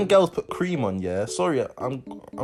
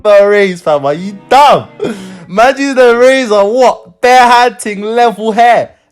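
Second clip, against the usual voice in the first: shocked; 9 semitones higher, 5 dB louder, slower than usual. Real, not acted. Shocked outburst at the razor.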